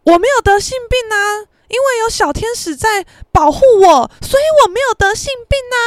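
loud, high-pitched blurting in class; loud and high, oblivious to who is listening; super loud. Retold or imitated. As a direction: blurt it out loudly and cheerfully for the whole class to hear. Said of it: "他就讲超大声说".

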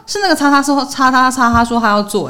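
high, taken-aback retold reply; 错愕反问. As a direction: act out baffled disbelief, pitched well up. 转述自己被问时的错愕. (错愕地拔高声音反问)

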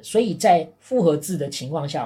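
ordinary talk, nothing marked

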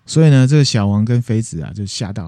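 normal talking voice

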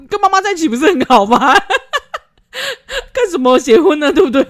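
high, loud, laughing taunt; mocking laughing jab; laughing mockery. Real, not acted. Mocking mama's boys.

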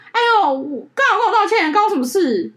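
retold bride, laughing and high; the bride shrugs off the apology, laughing. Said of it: "一个就说".

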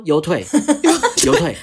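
loud, high, laughing blurts; half-laughing as you relive the panic; panicked laughing yell. Retold or imitated. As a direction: shout it again in mock panic, cracking up. Retelling a panicked shout.

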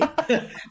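sudden high laugh at the blunt answer; high burst of laughter. Real, not acted. Friend laughing at the story, high sharp laugh.

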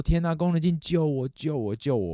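high plea for help, easing down as it repeats; panicked plea. Retold or imitated. Owner phoning sister for help, panicked plea. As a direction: beg for rescue in retold panic. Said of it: "我就在家里很慌张".

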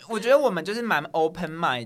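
ordinary talk, nothing marked

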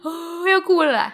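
high, slow, choked voice on the verge of tears; fighting back real tears as the words come; about to cry. Real, not acted. Telling her co-host about her dad, high, slow and near tears. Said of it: "我现在讲会哭欸".

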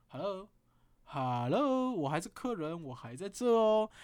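very slow, stretched hello, loud; sarcastic protest. Retold or imitated. Inner reply to the masseur, drawn-out sarcasm. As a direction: drag it out, offended customer. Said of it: "我想说".

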